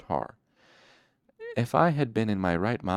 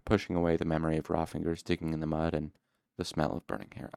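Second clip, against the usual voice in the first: ordinary delivery, nothing marked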